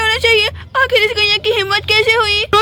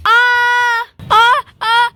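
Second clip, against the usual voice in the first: short loud yelps of pain; pained cries; 3 semitones higher, 3 dB louder, much slower than usual. Acted. Mamta crushed under the cow, loud pained cries. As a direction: cry out as the cow hits you.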